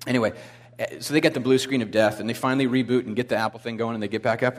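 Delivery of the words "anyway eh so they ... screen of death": ordinary talk, nothing marked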